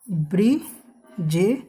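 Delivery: ब्रिजेश broken slowly into ब्रि, जे, श; slow teaching demo of ब्रिजेश with gaps; syllable by syllable. Shown as a demonstration. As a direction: sound out ब्रिजेश in separate pieces. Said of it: "जैसे एक word है".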